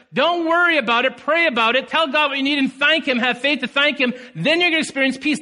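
high, urgent preaching; fervent preaching. Acted. Pastor urging the church to pray, preached with fervour. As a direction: drive the verse home.

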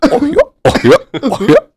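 high cartoon dog voice; goofy high-pitched cartoon voice. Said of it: "¿Puede hacer la de Goofy?".

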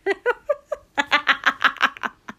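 high, loud villain's cackle; sudden cackle. A narrator's reading. Dabi mocking Shoto's plea, sudden loud cackle. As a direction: burst out cackling. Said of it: "Dabi cackled suddenly".

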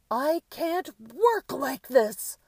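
very high, slow, furious yell; loud scolding. A narrator's reading. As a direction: shout it drawn out like a diva's tantrum. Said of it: "A woman's alto voice scolded loudly".

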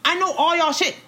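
high-pitched, indignant standoff; defiant. Acted. Daughter warning parents who threaten to ship her off, defiant. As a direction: push the pitch way up in mock outrage.

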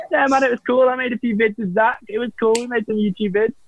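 mock-breezy, high-pitched throwaway reply; easy casual answer. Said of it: "could have answered that so easily".

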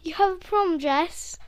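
loud, rising disbelief; shocked question. Retold or imitated. Friend asks about the prom dress, loud disbelieving rise.

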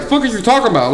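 retold heated argument; angry. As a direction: throw it back at him hard.

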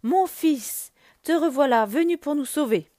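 a mother's happy cry on seeing her son again; queen greeting her returning son with joy; overjoyed. A narrator's reading. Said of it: "elle dit toute heureuse".

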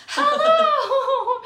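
loud, sing-out high hello; exaggerated high-pitched greeting. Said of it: "it's normally very high pitched".

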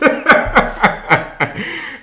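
high, loud, breathy burst of laughter; wheezing high laugh. Real, not acted. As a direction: lose it laughing at your own joke. Laughs at his own joke.

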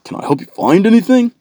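high, loud mock shop greeting; pushy sales associate. Sales associate swoops on a customer, high mock greeting. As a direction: pounce with over-eager, high-pitched helpfulness. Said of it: "some sales associate swells up on you".

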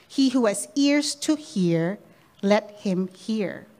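very slow, deliberate refrain; slow solemn refrain. Shown as a demonstration. Preacher driving home the refrain, slow and solemn. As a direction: let each word land slowly. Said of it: "repeated refrain".

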